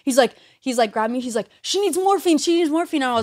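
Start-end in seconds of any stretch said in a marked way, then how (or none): 1.6-3.0 high-pitched mimicked alarm; urgent hospital worker. Man from the back calling for morphine, high and urgent. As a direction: call for help in a much higher, urgent voice.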